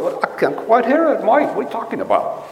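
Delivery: high-pitched mock Scottish brogue; broad Scottish accent. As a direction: put on a thick, hard-to-follow Scottish accent. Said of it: "it's just broad and Scottish accent".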